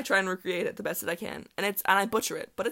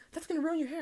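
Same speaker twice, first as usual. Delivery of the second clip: high, worried friend voice; alarmed friends; 10 semitones higher, faster than usual. Retold or imitated. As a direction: mimic a fussing friend, pitched high. Friends warn about blue dye.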